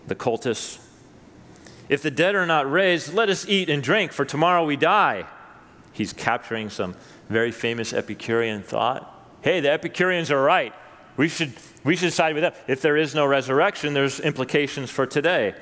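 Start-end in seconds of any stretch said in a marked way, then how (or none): 1.9-5.2 higher, louder, tossed-off eat-drink-and-be-merry call; mock carefree cry. Preacher voicing Epicureans to the congregation, mock carefree. 9.4-15.5 higher, louder, hammed-up cheer for the Epicureans; mock agreement. Preacher mocking Epicurean thinking for the congregation, hammed-up eager.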